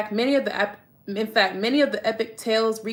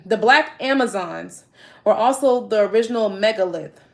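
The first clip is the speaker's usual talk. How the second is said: ordinary talk, nothing marked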